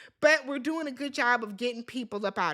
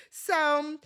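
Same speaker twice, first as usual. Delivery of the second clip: ordinary delivery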